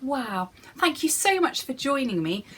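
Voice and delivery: usual speaking voice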